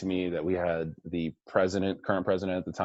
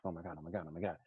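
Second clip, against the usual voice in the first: anxious murmur; 13 dB quieter, much faster than usual. Retold or imitated. Panicking before the relay.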